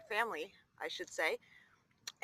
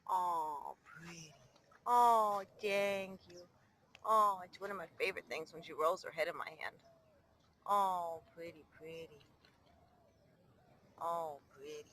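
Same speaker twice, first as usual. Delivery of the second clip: low, soft loving murmur; tender; 7 semitones lower, 7 dB quieter than usual. Real, not acted. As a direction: soothe the bird gently. Soothing the pet.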